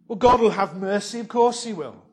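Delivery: high and loud, smugly sure. Retold or imitated. Preacher mocking the congregation's presumption, loud and sure. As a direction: toss it off as if mercy were owed.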